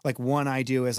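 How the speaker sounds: usual speaking voice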